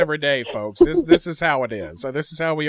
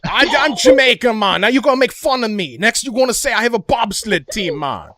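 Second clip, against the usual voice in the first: higher mock-offended Jamaican accent; 3 semitones higher than usual. Player protesting being made fun of, Jamaican accent. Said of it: "my Jamaican accent is original".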